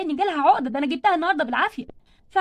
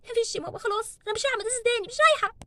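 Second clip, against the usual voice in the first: high, softer then rising to a cry; crying daughter refusing school to her mother; tearful wail; 5 semitones higher, 4 dB quieter than usual. Acted. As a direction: sob out the refusal.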